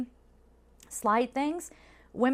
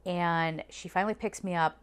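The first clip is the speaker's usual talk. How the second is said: normal talking voice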